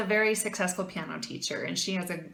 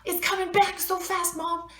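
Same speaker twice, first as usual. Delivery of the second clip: much higher, pouring it all out to mom; upset excited rant; 10 semitones higher than usual. Retold or imitated. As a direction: gush it in a rush, hurt and proud. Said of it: "I'm like".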